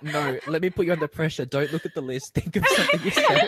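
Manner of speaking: high, loud, laughing imitation of a demand; mock bossy. Host teasing co-host for pressuring a guest, mock bossy. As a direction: playfully exaggerate someone piling on pressure. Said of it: "You're like, you're like".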